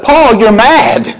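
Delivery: a governor scolding a man he thinks crazy; hammed-up scornful ruler; scoffing Festus. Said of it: "Festus speaks up".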